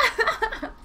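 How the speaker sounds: high burst of laughter; real laugh. Real, not acted. Streamer laughs at landing on hot sauce, real laugh. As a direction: laugh out high after the shock.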